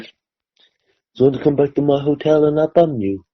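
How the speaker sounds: put-on soft, slow Brummie accent; mock Brummie accent. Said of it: "bumping into [name]".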